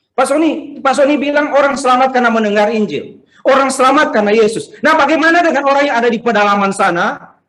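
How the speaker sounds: lightly acted, insistent challenger; imitated questioner. Church member challenging the pastor by message, imitated questioner. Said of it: "Itu saya, eh, baru beberapa hari lalu di W A".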